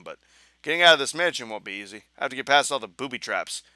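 normal talking voice

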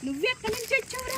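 high-pitched question to a dog; talking to a pet. Real, not acted. (కుక్కతో హెచ్చు గొంతుతో మాట్లాడుతూ)

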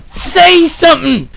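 loud, high demanding shout; yelling at him. Real, not acted. Gamer shouting at a silent villager to speak. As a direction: demand an answer at the top of his voice.

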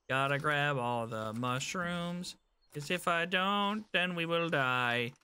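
normal talking voice

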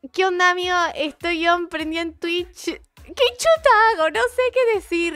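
high, loud mock-panicked voice; awkward story attempt. Streamer mocking own Instagram stories, high flustered voice. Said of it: "cuando tengo que hablar en las historias de Instagram".

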